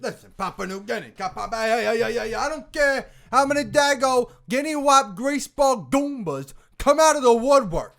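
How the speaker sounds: high, loud mobster tirade; movie-line second take. Acted. Actor performing a Godfather line for his co-hosts, loud high tirade. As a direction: belt out the mobster line, pitched well up. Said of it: "second take here".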